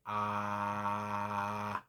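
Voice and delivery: wide open ah as if for the dentist. Shown as a demonstration. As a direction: open your mouth wide and say ah. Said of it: "they ask you to say".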